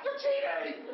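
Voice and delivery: high, loud indignant squeal; mock outrage. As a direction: shriek the accusation like a tattling kid. Catching the monk eating rice.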